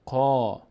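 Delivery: قا with a full, dark, rounded "qaw", held long; heavy qaf. Shown as a demonstration. Teacher showing a heavily read letter, heavy vowel on قا.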